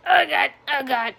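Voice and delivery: imitating a kid in a panic; mimicked panic. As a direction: act out a hammed-up fright. Mimics friend panicking at vegetable picture.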